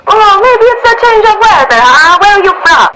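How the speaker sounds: loud, high, hammed-up Italian accent; bad Italian accent. Italian stranger trying to cheer up tourist, exuberant Italian accent. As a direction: cheer someone up in booming mock Italian. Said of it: "My Italian accent is so bad".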